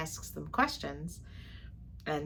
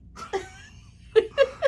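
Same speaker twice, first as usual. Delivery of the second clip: loud laugh; 8 dB louder than usual. Real, not acted. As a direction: burst of high laughter. Laughs at husband's mispronunciation.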